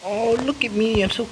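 high cooing cat character; playful, high baby-talk; cutesy kitten. Pretend. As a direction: coo like a preening little kitten. Voices the cute kitten.

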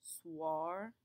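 Soir said swar with a clear W. Shown as a demonstration. Teacher isolating soir for students. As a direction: bring out the W in Soir. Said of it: "sounds like a W".

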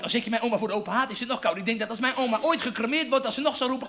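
ordinary delivery

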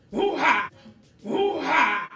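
very loud, high hoo-ha chant; imitated war cry. Imitating General Izzy's chant, loud war cry. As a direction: boom it out like a warrior's call. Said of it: "he had this".

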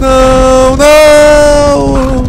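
high loud cry of defeat; despairing shout. Real, not acted. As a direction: shout no as it slips away. Losing at finish.

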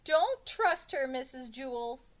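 high, slow, loud wail; drawn-out schoolboy plea; alarmed warning. A narrator's reading. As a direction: warn the teacher desperately. Pupil warns teacher in alarm.

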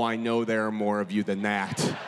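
ordinary delivery

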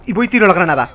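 usual speaking voice

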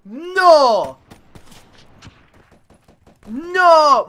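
long, loud wail of "no"; anguished yell. Real, not acted. Gamer wrecked by an opponent, wailing in protest. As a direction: cry out loudly in protest. Repeated cries after dying.

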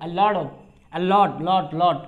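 lot with the t flapped like ড into of; American flap t. Shown as a demonstration. Teacher models the phrase for learners, flapped t. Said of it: "American accent অনুসারে ডর মতো হবে".

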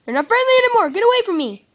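high panicked shriek. Real, not acted. Panicking as zombies attack.

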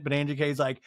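ordinary talk

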